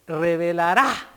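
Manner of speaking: "revelará" with the stress landing on the last syllable "rá"; stress on the final A. Shown as a demonstration. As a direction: put the accent on the A of "revelará". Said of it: "con acento en la A".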